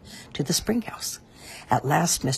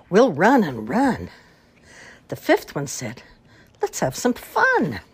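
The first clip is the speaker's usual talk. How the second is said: nursery rhyme recital. Acted. Recites a Halloween rhyme after the story.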